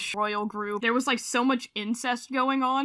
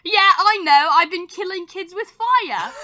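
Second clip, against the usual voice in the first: voicing child; 6 semitones higher, 6 dB louder than usual. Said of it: "And he's like".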